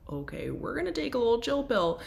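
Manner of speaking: high, fast, breezy self-talk; calming herself down. Retold or imitated. Answering her own craving, in a high, quick, breezy voice. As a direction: chirp it back at the goblin to settle it.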